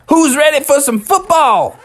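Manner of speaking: high, eager holler; rally the team before kickoff; pumped-up call to friends. Acted. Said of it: "and they said".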